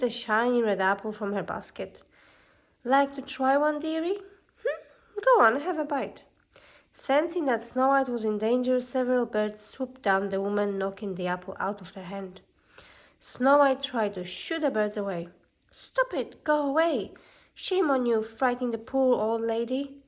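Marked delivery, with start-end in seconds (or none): 2.8-6.2 higher, sing-song crone urging a bite; coaxing old hag. Acted. Hag offers the apple. 15.7-20.0 put-on higher, urgent young girl shooing birds; scolding princess.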